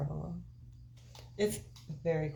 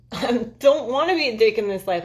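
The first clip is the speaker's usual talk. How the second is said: laughing it out, louder; 5 semitones higher, 8 dB louder than usual. Real, not acted. Laughing while telling viewers about past lives. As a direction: laugh through the protest.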